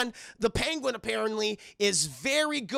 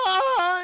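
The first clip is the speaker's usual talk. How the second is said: high, drawn-out mock wail; loud high wail; 12 semitones higher, 9 dB louder than usual. Mother hugging her departing son, mock wail. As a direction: cry out loudly, long and overcome. Mother reacts, crying over him.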